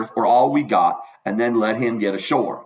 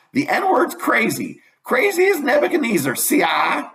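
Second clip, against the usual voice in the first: put-on high, excited gossiping old lady; higher gossip voice of an old country woman; Old Miss Hotchkiss; 5 semitones higher than usual. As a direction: rattle on like a shrill busybody at the dinner table. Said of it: "old Miss Hotchkiss".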